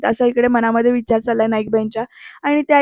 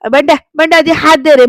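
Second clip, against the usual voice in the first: louder, insistent call; calling for help; 3 semitones higher, 7 dB louder than usual. Acted. Mother-in-law struggling to rise, calling her son over. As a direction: demand a hand getting up.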